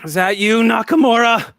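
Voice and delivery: high, loud call over the speakers; menacing woman's voice. Acted. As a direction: call out to someone through a whole facility. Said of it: "you hear a woman's voice go".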